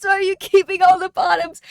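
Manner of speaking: high, loud, fast put-on questioner; mock chat voice. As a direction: play the nosy viewer, fast and high. Voicing chat's mock question.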